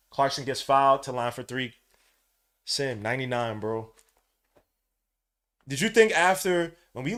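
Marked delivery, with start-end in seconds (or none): none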